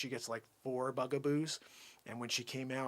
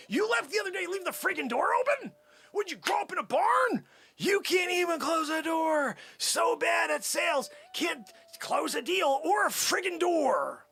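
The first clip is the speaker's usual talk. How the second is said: exasperated scolding; 6 semitones higher than usual. Acted. Boss scolds Tim about the door, exasperated. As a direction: chew him out, stretching the words.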